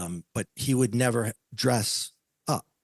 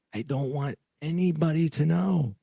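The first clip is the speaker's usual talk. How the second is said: urgent pleading; 6 semitones higher than usual. Retold or imitated. Pleading with wife, retold.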